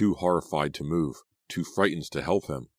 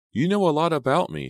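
high, lifted, breezy line; keeping it light; 12 semitones higher, 5 dB louder than usual. A narrator's reading. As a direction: pitch it up lightly, a little louder. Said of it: "trying to keep it light".

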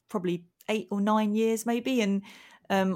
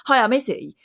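higher, pointedly curt mock greeting; retold prickly hello, pitched up; passive aggressive; 4 semitones higher, 6 dB louder than usual. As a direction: introduce yourself with passive-aggressive edge. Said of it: "really passive aggressive with him".

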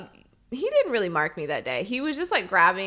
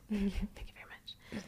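real soft whisper; whispered thanks; 14 dB quieter than usual. Real, not acted. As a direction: keep it hushed for the dog. Whisper while dog settles.

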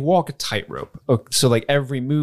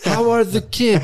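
Vision voice; 9 semitones higher, 3 dB louder than usual. Retold or imitated. Acting Vision in the scene.

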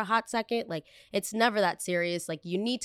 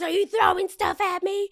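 much higher, louder, outraged clerk voice; the clerk shrieking in disbelief; shocked clerk; 13 semitones higher, 6 dB louder than usual. Retold or imitated. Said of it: "She goes".

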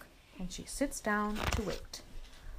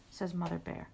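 usual speaking voice

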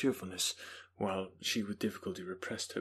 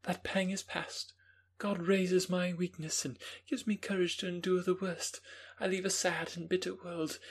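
put-on young Justine; 10 semitones higher than usual. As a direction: voice Justine as a calm young woman.

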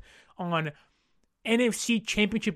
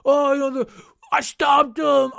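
mock Madden gamer; 4 semitones higher, 6 dB louder than usual. Host mocking Madden players who brag after doing nothing, in a loud put-on boast. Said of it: "the guys who are".

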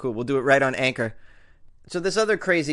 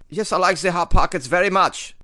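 louder, higher comic German voice; German accent; 4 semitones higher, 6 dB louder than usual. Acted. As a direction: play the dictator with a hammed-up German accent. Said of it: "This is my German accent".

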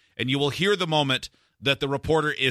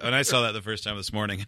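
ordinary delivery

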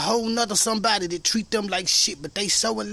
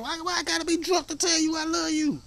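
higher-pitched, mildly incredulous comeback; mock indignant protest; 7 semitones higher than usual. Son answering pop's drinking suspicion, mock indignant. Acting his comeback to pop.

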